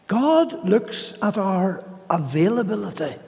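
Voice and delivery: normal talking voice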